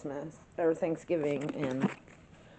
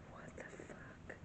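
breathless whispered curse; stunned hushed whisper; shocked whisper; 22 dB quieter than usual. Retold or imitated. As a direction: whisper it in disbelief. Shocked reaction to stranger's text.

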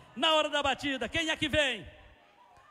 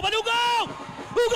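goal shout; 6 semitones higher than usual. Real, not acted. Goal scored in shootout.

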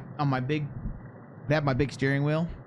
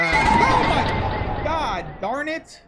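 startled laughing shout; frightened yell into laughter; scared horror game streamer; 10 semitones higher, 5 dB louder, much slower than usual. Real, not acted. Startled mid-sentence, laughing.

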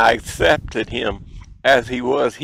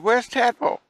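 worried mom; 11 semitones higher than usual. Retold or imitated. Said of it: "she said".

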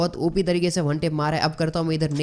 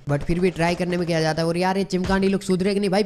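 usual speaking voice

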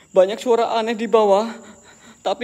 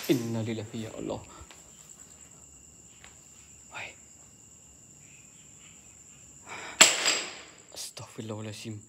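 much softer, low frightened murmur; scared hushed invocation; hushed prayer; 10 semitones lower, 14 dB quieter than usual. Real, not acted. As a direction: pray under your breath. Frightened, praying under breath.

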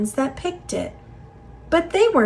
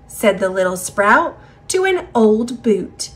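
ordinary delivery, nothing marked

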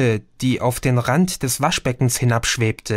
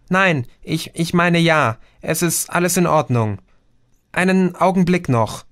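called out; 6 semitones higher than usual. A narrator's reading. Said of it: "rief Chrissy".

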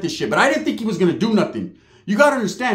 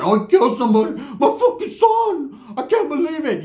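hammed-up wailing aunt; 8 semitones higher than usual. Aunt wailing about her son's arrest, mock distraught. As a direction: overplay the mother's disbelief, pitched up.

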